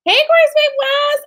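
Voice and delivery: high, loud, drawn-out greeting; calling out. Real, not acted. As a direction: hail a friend excitedly. Greets someone by name, excitedly.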